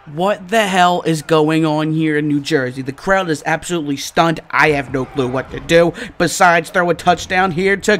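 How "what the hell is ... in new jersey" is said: ordinary delivery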